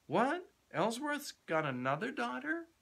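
high, startled outburst; young man stunned by the news, crying out; surprised cry. A narrator's reading. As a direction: blurt it out in shock. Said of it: "cried the young man".

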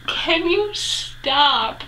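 high, very drawn-out protest; hammed-up slow, embarrassed whine; mock exasperation. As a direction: imitate a mortified daughter begging him to quit. Retells embarrassed reply to his teasing.

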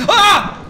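sudden high yelp of fright; startled scream. Real, not acted. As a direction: shriek in surprise. Startled in game.

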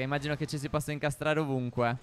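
ordinary delivery

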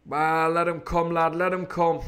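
a light pirate voice, cheerfully unbothered; cheery pirate. Acted. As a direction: shrug them off with a grin in the voice. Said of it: "said Silver cheerily".

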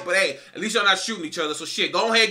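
usual speaking voice

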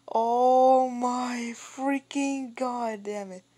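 high, loud, drawn-out wail; alarmed outburst. Real, not acted. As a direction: stretch every word into a loud cry. Frightened by the approaching banana.

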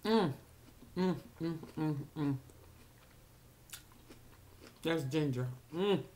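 chewing while humming; eating corn on the cob; mouth full. Real, not acted. Seen 2: Corn held at mouth, biting and chewing through the labelled hums.

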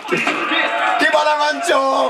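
shouted high Jamaican dancehall chant; loud Jamaican accent. Retold or imitated. Player shouting [name]'s hook in a Jamaican accent. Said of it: "I can't do the accent right".

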